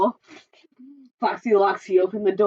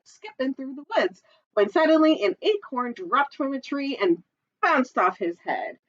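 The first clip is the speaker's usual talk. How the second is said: ordinary delivery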